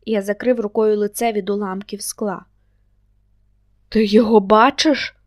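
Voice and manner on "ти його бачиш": loud, alarmed question; startled, raised-voice question; frightened. A narrator's reading. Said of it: "Саша злякався не на жарт".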